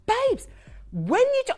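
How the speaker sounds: high, loud impression of an emphatic Brummie colleague; imitating Rach. Host imitating her buyer friend Rach, high and loud. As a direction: squeal it out like an excitable friend. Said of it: "You can hear her".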